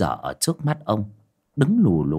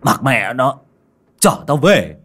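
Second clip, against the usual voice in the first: put-on louder, brusque drunken order; drunken belligerence; 2 semitones higher, 5 dB louder, slower than usual. A narrator's reading. Drunk master brushing off the ghost, belligerent order. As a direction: order him home aggressively. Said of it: "cậu hung hăng".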